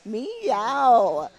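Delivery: loud mock cat meow; playful meow. Co-host joking about Catter Day, loud playful meow.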